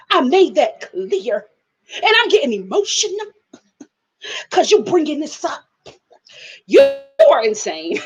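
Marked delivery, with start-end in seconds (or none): 0.1-5.6 mock Sarah. Mimics Sarah again.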